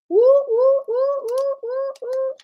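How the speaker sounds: high, piercing woo-woo cheer; high whooping celebration; excited woo. Real, not acted. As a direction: hoot like a cheering fan. Celebrating cousin news.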